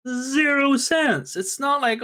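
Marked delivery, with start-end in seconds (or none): none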